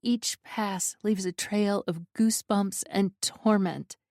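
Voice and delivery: usual speaking voice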